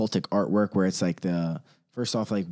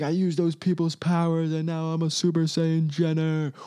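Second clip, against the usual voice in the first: lightly voiced boastful villain; a touch of gloating cartoon villain; mock villain boast; 6 semitones higher than usual. Mocking the celebrity's imagined gloat, raised and gleeful. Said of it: "It's like".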